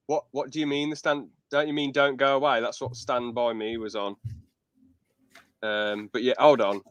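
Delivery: ordinary talk, nothing marked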